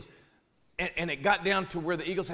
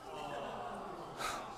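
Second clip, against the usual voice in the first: deflated sigh; 3 semitones lower, 15 dB quieter than usual. Acted. Deflated sigh after bad news.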